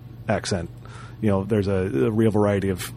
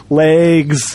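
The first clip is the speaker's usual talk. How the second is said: Legs. pitched up and a bit louder, falling at the end, right after a laugh; laugh, then toss Legs. back playfully; laughing echo; 5 semitones higher, 4 dB louder than usual. Pretend. Host laughs and echoes Legs. back to co-host, amused.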